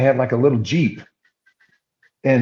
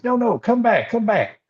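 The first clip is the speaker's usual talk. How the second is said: calling back; 11 semitones higher than usual. Retold or imitated. Farmer calls fleeing kid back.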